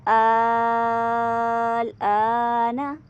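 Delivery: आना stretched five counts, very slow; long madd. Shown as a demonstration. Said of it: "इसकी मिकदार पाँच अलिफ है". (आना को पाँच अलिफ खींचकर)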